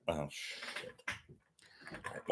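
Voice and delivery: whispered under breath after spill; muttered curse. Real, not acted. Host spilling water, whispered muttered curse. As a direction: quietly swear to yourself. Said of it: "I spilled water".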